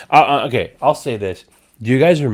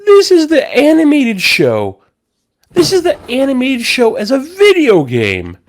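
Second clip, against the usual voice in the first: much higher, louder amazed cry; awed excitement; 5 dB louder than usual. Retold or imitated. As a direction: marvel at it, voice soaring. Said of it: "and I was like".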